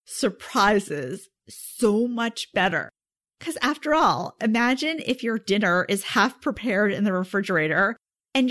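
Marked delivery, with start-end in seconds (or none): 0.1-2.9 very slow, knowing "surprises"; drawn-out, sarcastic "surprises"; ironic emphasis. Pretend. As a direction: stretch "surprises" out slowly with a wink. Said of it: "that was actually a euphemism".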